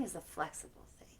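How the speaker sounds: fast storybook whisper. Acted. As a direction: hush it quickly.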